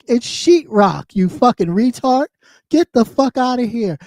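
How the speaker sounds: ordinary talk, nothing marked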